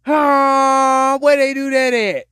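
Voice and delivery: half-crying loud huh of disbelief; incredulous yell. Real, not acted. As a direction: throw the question out in disbelief. Incredulous outburst.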